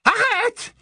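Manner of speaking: very high, piercing yell; shriek. Acted. Bellatrix screams at Lucius to stop, shrieking. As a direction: shriek it as an urgent order. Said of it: "hurla Bellatrix".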